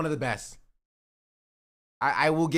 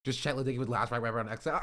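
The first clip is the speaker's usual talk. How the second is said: ordinary talk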